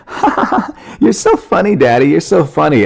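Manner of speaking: very high fake kid giggle, then gushing; imitated daughter faking laughter. Daughter pretending she got daddy's video, high fake-laughing child voice. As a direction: squeal it like a child pretending to get the joke. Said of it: "she was just trying to pretend".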